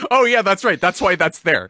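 loud, high mock realisation; acted realisation. Host recalling hearing the speak button, loud high realisation. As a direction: act out suddenly getting it. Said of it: "I was like".

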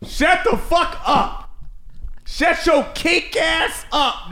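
high, slow disbelieving shout; shocked yelling. Real, not acted. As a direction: yell it in shocked disgust.

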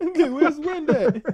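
very high, slow and laughing; laughing mock question. Friend joking about the missing hero, high and laughing. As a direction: squeal it through a laugh. Mocking missing Wind planeteer.

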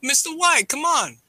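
very high mock plea; Jesse impression. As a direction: plead in a high put-on voice.

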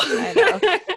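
high laughing reply. Real, not acted. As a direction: laugh through the words. Laughing at partner's weak pitch.

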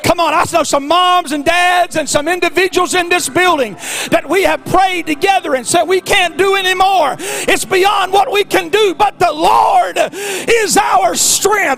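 preaching at full force. Acted. Preacher recalling prayers with families, shouted. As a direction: cry it out to the congregation.

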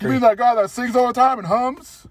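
high, loud mocking question; teasing. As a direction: poke fun at the humming. Teasing question about [name].

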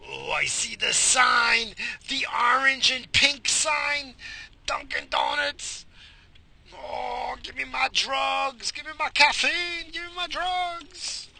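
high, slow, drawn-out mock craving; hammed-up happy craving. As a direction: wail like an addict sighting a fix. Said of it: "I'm all happy and shit. Like, I'm all like".

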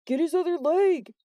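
high, loud, urgent command; yelled order. A narrator's reading. Jordan to friends while gripping the intruder, urgent yell. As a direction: yell for backup mid-struggle. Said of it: "Jordan yelled".

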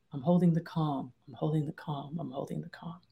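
repeated mantra fading from soft to barely there; self-soothing mantra. Shown as a demonstration. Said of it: "I literally will say to myself".

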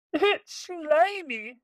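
very high, slow disgusted voice; disgusted pirate. Acted. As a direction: whine it with disgust. Pirate complains.

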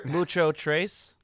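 put-on slow, playful Spanish-accented Mucho Trace; hammed-up Spanish accent, slow; mock Spanish accent. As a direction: roll it out with a cheesy Spanish accent for Cinco de Mayo. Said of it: "I'm giving a Spanish theme".

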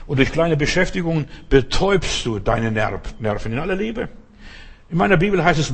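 ordinary talk, nothing marked

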